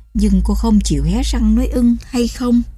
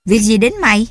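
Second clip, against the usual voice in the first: louder, curt fatherly snap; harsh retort; 3 semitones higher, 5 dB louder, faster than usual. Acted. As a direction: cut the daughter off roughly. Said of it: "sẵn giọng hỏi". (quát gắt "mày" như người cha nổi nóng)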